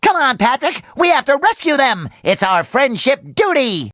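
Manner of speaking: ordinary delivery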